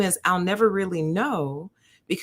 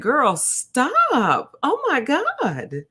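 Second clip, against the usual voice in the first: very high, slow, louder exclaim; high teasing disbelief; 12 semitones higher, 4 dB louder, slower than usual. Retold or imitated. To the nurse again, high loud playful disbelief. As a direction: shriek it up in playful shock. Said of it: "I said".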